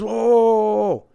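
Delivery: high, loud string of whoas; loud protest. Retold or imitated. Dealer acting out the player told he's done, loud protest. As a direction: imitate someone loudly objecting. Player reacting to being thrown out.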